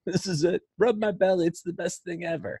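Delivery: high-pitched happy dog; blissful dog voice. Acted. Host voicing a dog wanting a belly rub, high and delighted. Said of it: "You can just hear it in their head".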